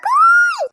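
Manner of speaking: one loud, high "kooy"; jeering hoot. Acted. Girl hooting at Ikru to tease him, high loud jeer. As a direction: let out a piercing, drawn-out hoot. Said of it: "ആ പെണ്ണിന്റെ കൂക്കൽ".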